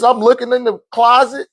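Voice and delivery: ordinary talk, nothing marked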